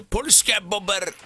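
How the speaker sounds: high, slow mock Polish: Polska bobber with rounded o; mock Polish accent. Streamer imitating what Polish people say, mock Polish accent. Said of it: "I hear Polish people say bobber".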